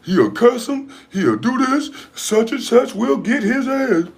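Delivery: high, put-on gossiping voice; mocking podcast men. Grown men on a podcast talking up a fight, high mocking imitation. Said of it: "I'm listening to their podcast".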